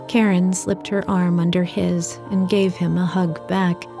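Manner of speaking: usual speaking voice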